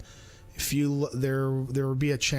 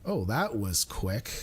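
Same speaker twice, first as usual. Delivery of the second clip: ordinary delivery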